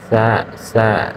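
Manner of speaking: সা stretched very slowly, twice, as a model; drawn-out sa. Shown as a demonstration. Teacher modelling সা slowly for beginners. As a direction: draw out সা for the learners. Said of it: "এরপর ছা".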